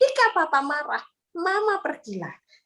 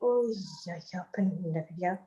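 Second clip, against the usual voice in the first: softer, lower voice saying oh iya; a child quietly conceding, slower; child admitting to father, soft and low; child realising; 7 semitones lower, 7 dB quieter, slower than usual. Retold or imitated. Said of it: "Anak mikir".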